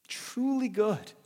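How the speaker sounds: "truly good" high, loud and slow; emphatic. Acted. Preacher stressing "truly good" to the listeners. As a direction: lean hard on "truly good".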